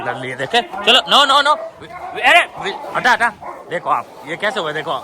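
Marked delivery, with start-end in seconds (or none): 0.6-3.3 sharp high calls to stop the dogs fight; high urgent shouts. Real, not acted. Owner shouting high at fighting dogs to separate them. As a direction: yell high to break them apart.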